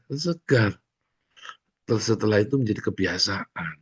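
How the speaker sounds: ordinary talk, nothing marked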